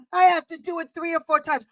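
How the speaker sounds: usual speaking voice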